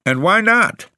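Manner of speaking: sharp, high-pitched challenge; snapped retort. A narrator's reading. Tenant challenging the caretaker about keeping servants, snapping. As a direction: snap it back, pitch shooting up. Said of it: "I snapped".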